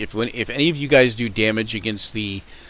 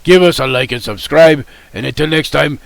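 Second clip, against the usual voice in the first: put-on louder, grand Russian-accented sign-off; 3 semitones higher, 7 dB louder than usual. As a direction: boom out a hammy Russian farewell. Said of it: "I'm doing terrible at this Russian accent".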